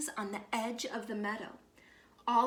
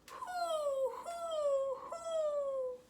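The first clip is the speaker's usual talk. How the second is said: high distant owl hoots; an owl answering from the trees; high, hollow reply hoots; much slower than usual. Acted. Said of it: "an echo came threading".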